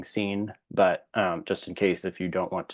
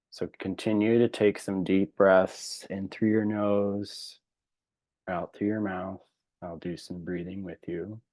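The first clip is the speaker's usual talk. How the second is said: spacious, calming breathing guidance, phrases trailing softer; guided meditation; 3 dB quieter than usual. Real, not acted. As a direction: guide them gently into relaxation, unhurried.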